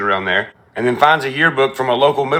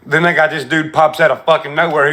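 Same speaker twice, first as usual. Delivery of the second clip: normal talking voice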